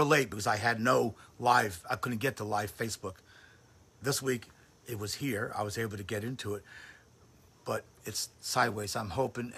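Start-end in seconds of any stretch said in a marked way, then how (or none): none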